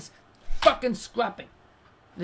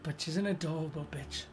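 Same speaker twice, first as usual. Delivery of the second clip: calmed-down regret; 6 semitones lower, 8 dB quieter than usual. Acted. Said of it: "he'll calm down and go".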